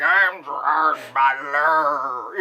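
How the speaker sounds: put-on drawn-out drunken slur; hammed-up drunk impression, very slow; Gerard Butler. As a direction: slur it slowly like a drunk tough guy. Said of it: "Gerard Butler all kinda drunken".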